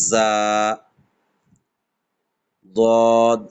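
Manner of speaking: sharp জা, then a heavy দদ; emphatic recitation of জা and দদ; Arabic letters demo. Shown as a demonstration. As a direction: give জা a bite from the tongue side. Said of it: "জাটাকে একটু ঝাঁঝালো করে".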